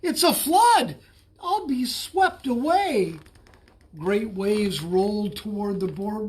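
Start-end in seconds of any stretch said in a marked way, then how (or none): 0.0-3.2 high, loud alarmed shout; panicked cry. A narrator's reading. Bullfrog caught in the flood, loud panicked cry. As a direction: yell it in terror of the water. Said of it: "he cried".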